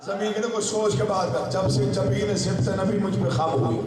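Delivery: high recitation voice, measured phrases; declaimed verse debate. Acted. Sky and earth trading claims of rank, high declaimed verse. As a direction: hold the pitch up and proclaim each line.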